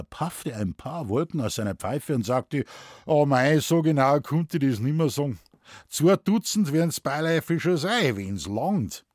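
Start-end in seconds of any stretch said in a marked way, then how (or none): 3.1-9.0 higher-pitched Bavarian forester, casual; Moosbichler. Acted. Forester tallies his victims for the scholar, offhand Bavarian. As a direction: reckon it up offhand in broad dialect.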